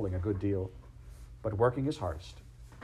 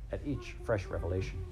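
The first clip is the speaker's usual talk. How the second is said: usual speaking voice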